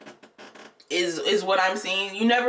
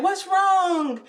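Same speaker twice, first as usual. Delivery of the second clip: concerned teacher question; 9 semitones higher than usual. Retold or imitated. Teacher asks a crying boy, concerned. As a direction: imitate a teacher asking what's the matter.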